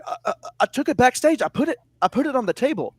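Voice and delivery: high, fast stammering excuse; flustered rookie stammering; nervous trainee. Retold or imitated. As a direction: rush it out like a scared kid. Nervous trainee voiced in story.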